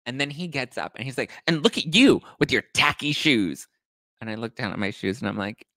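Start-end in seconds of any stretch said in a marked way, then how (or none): 1.5-3.7 high, loud mock insult; hammed-up indignant dig; going off, catty. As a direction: imitate a drunk throwing shade. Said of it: "he's, like, going off me".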